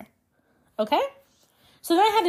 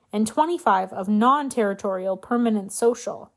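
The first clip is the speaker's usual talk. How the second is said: ordinary talk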